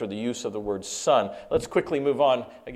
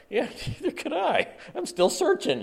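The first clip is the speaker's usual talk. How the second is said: much higher, light chuckling; laughing admission; 13 semitones higher than usual. Retold or imitated. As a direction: laugh at yourself. Laughing self-deprecating quote.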